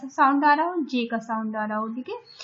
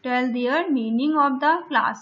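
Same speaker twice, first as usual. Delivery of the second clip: slow task reading; much slower than usual. Shown as a demonstration. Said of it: "teacher reading the task".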